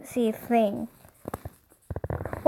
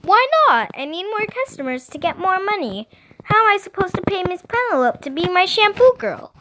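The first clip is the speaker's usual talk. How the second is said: put-on high, quick childish protest; whining little sister; 7 semitones higher, faster than usual. A narrator's reading. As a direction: argue back like a small child. Little sister whines back.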